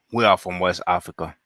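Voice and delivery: ordinary talk, nothing marked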